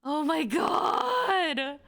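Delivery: high, slow, near-tearful gush; overwhelmed cry. Real, not acted. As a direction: let it break out of you, drawn out. Overwhelmed watching the video.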